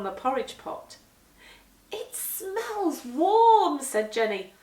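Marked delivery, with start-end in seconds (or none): 1.9-3.8 higher and louder, very slow and drawn out; dreamy delight. Acted. Jenny sniffing the pot, dreamily delighted by the smell. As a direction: savour each word as you sniff.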